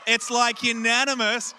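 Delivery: loud, high call to the room; excited shout. Real, not acted. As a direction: proclaim it like a big reveal. Excited announcement of the vote.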